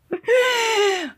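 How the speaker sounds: sudden high-pitched burst of laughter. Real, not acted. Laughing at having called her friend a golfa. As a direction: cackle at her own joke.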